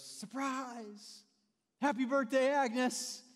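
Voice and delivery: loud, high group yell of surprise; surprise shout. Retold or imitated. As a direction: burst out shouting as the door opens. Crowd springs surprise party.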